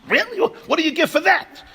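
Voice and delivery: high, squeaky disbelief; incredulous. Retold or imitated. Stingy man shocked by the price, squeaking in disbelief.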